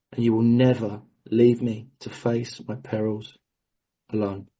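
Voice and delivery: low-pitched, measured prayer reading; steady recited prayer, firm on key words. Real, not acted. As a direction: pray aloud line by line, with solemn pauses. Reads closing prayer.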